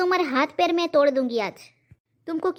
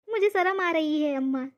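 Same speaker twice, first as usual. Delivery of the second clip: ordinary talk, nothing marked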